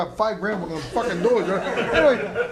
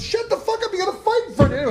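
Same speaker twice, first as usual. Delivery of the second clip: high, loud retold yell at the fighter; angry yell; 12 semitones higher, 3 dB louder than usual. Corner man yells at his boxer to focus, angry.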